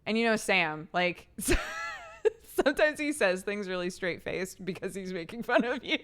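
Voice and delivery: ordinary delivery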